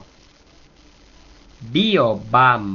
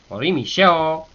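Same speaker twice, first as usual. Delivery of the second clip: "Ori mi sheo" with "sheo" lifted high; teacher's exaggerated accent on "sheo"; accented "she"; 6 semitones higher, 4 dB louder than usual. Shown as a demonstration. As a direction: raise and lean on "she" in "sheo". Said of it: "con acento".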